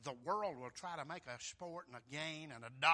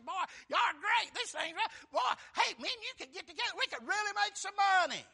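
much higher, loud, fast and gushing with a laugh; flattering huckster; 9 dB louder, faster than usual. Retold or imitated. Simon sweet-talking Peter and John about profit, high gushing huckster. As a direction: play the greedy schmoozer buttering them up. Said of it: "talk to Peter and John and say".

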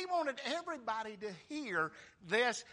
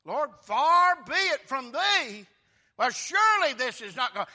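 loud rebuke; 3 semitones higher, 9 dB louder than usual. Retold or imitated. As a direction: rebuke the Lord loudly. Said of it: "What he says".

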